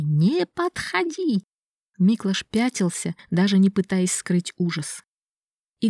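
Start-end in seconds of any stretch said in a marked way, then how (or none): 0.0-1.4 higher, slow, terrified plea; panicked plea. Acted. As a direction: beg him to stay back. Miklash backs away in terror. (паническая мольба)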